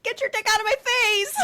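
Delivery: high, fast retold protest; high-pitched comic outrage; mock outraged yell. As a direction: imitate a pinned man squawking to be let go. Retold man pinned in leg lock protesting.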